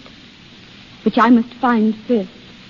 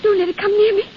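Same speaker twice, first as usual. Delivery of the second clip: frightened; 6 semitones higher, faster than usual. Acted. Grazia wakes terrified after attack.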